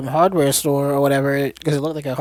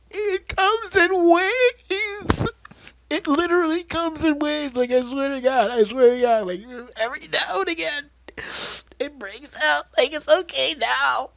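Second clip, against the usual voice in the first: sappy aunt; 12 semitones higher than usual. Retold or imitated. Aunt explaining the outbreaks to her niece, mock sappy. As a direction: ham up a weepy, sappy confession. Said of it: "this whole sappy thing where she's like".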